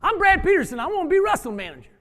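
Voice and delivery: put-on the boy announces himself proudly; Brad's voice. Voicing Brad introducing himself.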